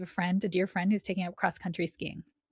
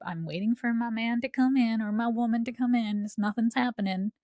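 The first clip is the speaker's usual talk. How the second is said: put-on southern accent; 4 semitones higher than usual. Waiting on a relationship, complaining in a southern accent. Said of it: "southern accent there".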